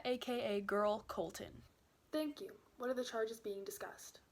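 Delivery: usual speaking voice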